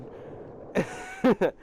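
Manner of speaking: real voiceless laughter; breathy giggle. Real, not acted. Recalling friend laughing so hard, real giggle.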